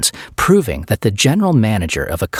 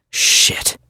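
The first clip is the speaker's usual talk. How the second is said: breathless whispered swear; whispered curse. Acted. Derek grabbed from behind, whispered curse. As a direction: hiss it under your breath.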